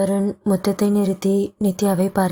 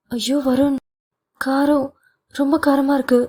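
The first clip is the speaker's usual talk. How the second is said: put-on higher-pitched, mildly distressed young wife; a touch of pleading discomfort, pitched up; slightly teary distress; 6 semitones higher than usual. A narrator's reading. Nithya tells her husband her mouth is burning, mildly teary. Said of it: "லேசாக கண் கலங்கியபடி".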